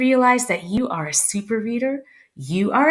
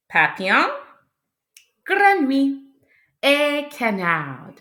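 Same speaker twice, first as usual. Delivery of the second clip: ordinary talk, nothing marked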